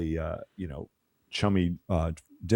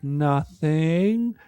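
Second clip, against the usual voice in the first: put-on high small child's answer; little girl voice; 9 semitones higher than usual. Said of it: "she's like".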